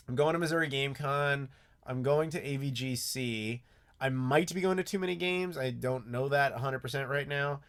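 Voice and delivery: usual speaking voice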